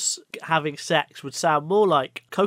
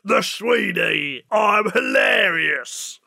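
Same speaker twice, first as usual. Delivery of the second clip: slow, loud gruff cockney shout; Ray Winston impression; 4 semitones higher, 4 dB louder, much slower than usual. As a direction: bellow it slowly like a hard man. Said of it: "my brilliant impression of Ray Winston".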